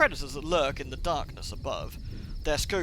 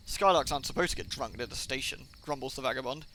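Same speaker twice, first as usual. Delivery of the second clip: ordinary talk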